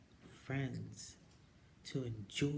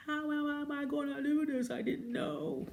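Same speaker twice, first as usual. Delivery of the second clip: much higher, strained fretting; panicked worry; 5 dB louder than usual. Retold or imitated. Retelling her CDL worries, high panicky voice. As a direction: squeak out the self-doubt. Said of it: "I was like".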